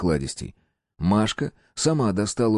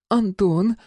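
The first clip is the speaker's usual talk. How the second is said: calling out; 12 semitones higher than usual. A narrator's reading. Woman calls.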